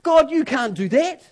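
high, loud cry of protest. Retold or imitated. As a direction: throw the objection up at God. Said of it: "He says".

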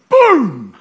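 shouted high boom, cut short; Nevin's boom. Retold or imitated. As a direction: punch out a sudden boom like Nevin does. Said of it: "he has got this way of saying boom".

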